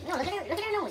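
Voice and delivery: excited. Real, not acted. Laughing at friend's red nose.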